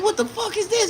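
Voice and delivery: high-pitched retold disbelief; appalled disbelief. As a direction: squeal it in mock outrage. Retold reaction to unwanted dressing.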